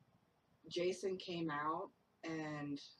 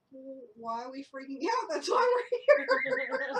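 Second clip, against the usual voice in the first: rising to a high, loud, laughing exclaim; mock indignation climbing up to a squeal; exasperated outburst; 8 semitones higher, 11 dB louder than usual. Retold own exasperated outburst.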